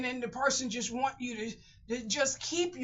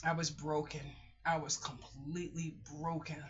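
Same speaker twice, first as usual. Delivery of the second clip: quiet, lowered voice, heavy with defeat; low, hushed sadness; 6 semitones lower, 8 dB quieter than usual. Real, not acted. Confiding being broken over the rent situation, quietly sad.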